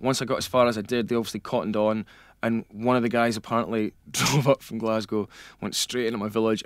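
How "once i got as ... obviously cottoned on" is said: ordinary talk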